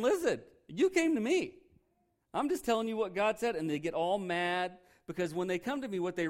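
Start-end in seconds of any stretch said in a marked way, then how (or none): 0.0-3.6 exasperated retort. Retold or imitated. Retelling his reply to angry people.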